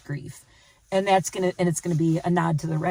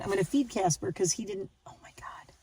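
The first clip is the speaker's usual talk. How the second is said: whisper; 7 dB quieter than usual. Real, not acted. Near sleeping dog.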